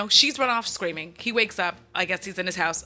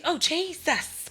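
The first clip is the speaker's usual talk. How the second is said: real high-pitched jolt of fright; startled; 8 semitones higher, slower than usual. Real, not acted. Speaker jumps as the phone goes off, startled. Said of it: "My phone just scared the shit out of me".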